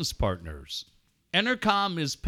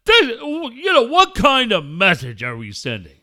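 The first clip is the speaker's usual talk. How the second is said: loud, higher-pitched put-on moralizing voice; mock pompous complainer; 5 semitones higher, 8 dB louder than usual. Host parodying self-righteous critics, loud put-on voice.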